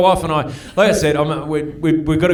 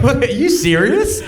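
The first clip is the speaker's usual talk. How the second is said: incredulous; 9 semitones higher than usual. Retold or imitated. Acts out his disbelieving reaction.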